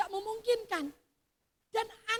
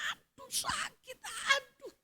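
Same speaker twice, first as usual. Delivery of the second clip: pained moaning; 8 semitones higher, slower than usual. Retold or imitated. Patient telling of pain at the slightest touch, drawn-out mock moan. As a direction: imitate a patient wailing in pain. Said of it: "ketika dia berkata".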